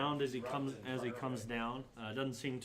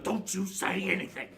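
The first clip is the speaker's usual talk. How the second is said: higher, louder goblin order; warning goblin; 5 semitones higher, 5 dB louder than usual. Acted. Goblin warning buddy before intruder, sharp order.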